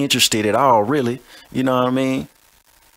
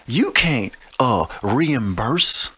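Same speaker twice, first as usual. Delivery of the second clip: reimburse dragged out very slowly; drawn out; much slower than usual. Shown as a demonstration. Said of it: "Do you even know what reimburse means".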